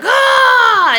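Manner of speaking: high loud scream. Retold or imitated. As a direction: shriek in fright. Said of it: "I screamed really loud".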